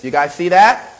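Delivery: ordinary delivery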